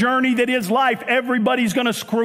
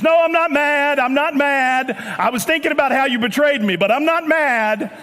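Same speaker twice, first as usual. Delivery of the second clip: insistent, tightly annoyed protest; mock "not mad" denial; 3 semitones higher than usual. Preacher plays Jesus denying anger to Peter, mock-irritated. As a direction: deny the anger while plainly showing it. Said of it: "It feels like you're mad".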